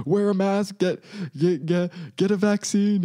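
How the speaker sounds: mocking preachy. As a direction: parrot them in a sing-song sneer.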